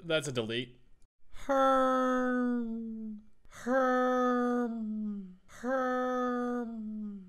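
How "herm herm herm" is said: high hummed villager grunt. Acted. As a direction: hum it like a Minecraft villager. Let's do villager.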